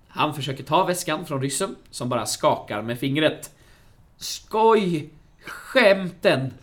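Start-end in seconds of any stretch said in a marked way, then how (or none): 4.2-6.5 higher, louder nervous outburst; acted nervous joking. Sickan to the Russian in a retold film, nervously jovial. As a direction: play it off as a joke, nervously and loudly. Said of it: "skrattar Sickan nervöst".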